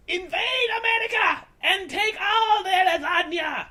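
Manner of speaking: high, loud villain shout; wicked shouted order. Acted. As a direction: bellow the order like a cartoon villain. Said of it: "ordered Redcoat Commander with wickedness".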